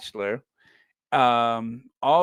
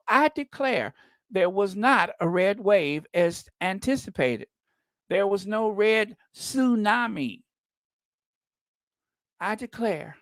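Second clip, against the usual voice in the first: mock him as a fainting Southern belle; 4 semitones higher than usual. Quoting the senator on TV as a Southern belle. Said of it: "that Southern belle, Lindsey Graham".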